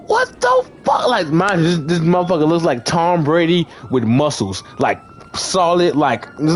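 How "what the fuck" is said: very high mock-shocked squeal; stunned disbelief. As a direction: stretch it out high, acting dumbfounded. Retold shock at stranger's answer.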